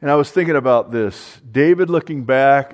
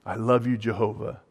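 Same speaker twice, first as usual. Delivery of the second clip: "I love you, Jehovah" softer, gently, "Jehovah" ending on a slight rise; soft tender repeat; 3 semitones lower, 7 dB quieter than usual. Shown as a demonstration. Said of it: "with the understanding of how Jehovah loved him".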